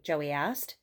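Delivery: usual speaking voice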